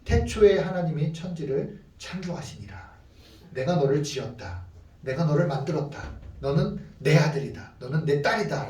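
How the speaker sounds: soft, low, measured proclamation; hushed, sincere scripture voice with pauses; solemn divine declaration. Acted. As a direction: speak as God quietly assuring children. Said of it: "하나 우리에게 이렇게 말씀하십니다".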